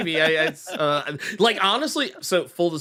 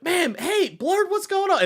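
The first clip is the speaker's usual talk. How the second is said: much higher, cheery mock chatter; chatter greeting; 14 semitones higher than usual. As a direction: greet Blur brightly, ignoring the other host. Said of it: "some people are like".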